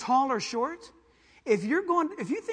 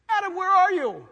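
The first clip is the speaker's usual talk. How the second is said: high, loud call; 10 semitones higher, 11 dB louder than usual. Acted. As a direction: call out searching. God calling out again.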